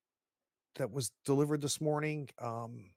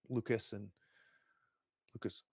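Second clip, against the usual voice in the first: ordinary delivery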